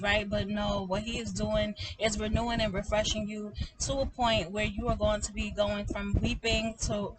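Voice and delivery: ordinary talk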